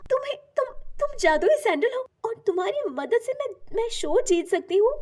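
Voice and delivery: high-pitched, halting disbelief; astonished girl's voice; amazed. A narrator's reading. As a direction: stammer in wonder at a magical offer. Girl amazed by talking sandal.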